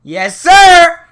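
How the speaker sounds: booming, high-pitched shout; sudden loud whoop. Real, not acted. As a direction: belt it out far too loud. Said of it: "Sorry, that was loud".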